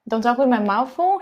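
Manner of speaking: usual speaking voice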